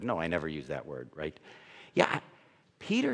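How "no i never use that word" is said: a lightly voiced, quick, lower prissy listener; mock prim denial. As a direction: brush it off in a hurry.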